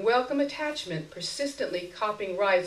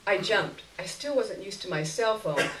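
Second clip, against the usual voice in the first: usual speaking voice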